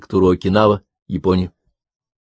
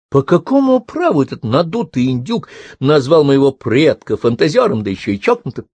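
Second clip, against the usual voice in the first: higher-pitched, mildly indignant tirade; 7 semitones higher than usual. A narrator's reading. Karen telling Jack about insult to her great-grandfather, mildly indignant. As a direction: protest heatedly, a touch affronted. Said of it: "Карен сердито посмотрела ... этот гнев напускной".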